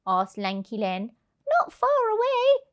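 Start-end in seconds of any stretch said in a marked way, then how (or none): 1.6-2.6 high chirpy voice; helpful hen. Acted. Hen luring the thieves, high and chirpy. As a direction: chip in brightly. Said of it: "chipped in the hen".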